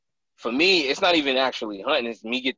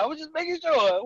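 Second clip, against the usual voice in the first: mock innocent. His own reply voiced high.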